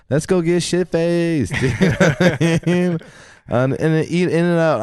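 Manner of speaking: telling friends he eats junk, lazy and carefree; carefree partier. Retold or imitated. His carefree party voice.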